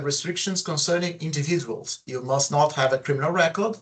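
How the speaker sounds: ordinary talk, nothing marked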